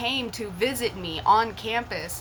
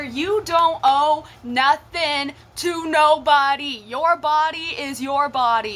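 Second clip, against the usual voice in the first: loud, firm preaching to the viewers; emphatic lecture; 4 semitones higher, 5 dB louder than usual. Real, not acted. Warning younger viewers about their bodies, loud and firm.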